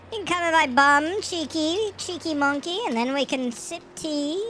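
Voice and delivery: high cheeky voice; silly high voice. Acted. Silly voice mocking donation TTS.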